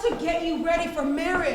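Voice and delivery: loud, pushing the point hard; forceful insistence. Acted. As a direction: drive it home over her protests. Aunt pushes cutting on girl.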